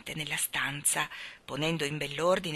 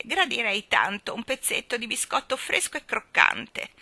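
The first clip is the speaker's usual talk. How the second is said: higher, wheedling request; timid, caressing voice; 5 semitones higher, 4 dB louder than usual. A narrator's reading. Starling begs the baby for biscuit, wheedling. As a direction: coax for a treat with rising pitch. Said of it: "con voce timida e carezzevole".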